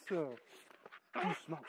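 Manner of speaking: very soft and slow, drawn out; soft, slow calming word; slow soothing. Real, not acted. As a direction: ease the dog down gently. Calming the dog down.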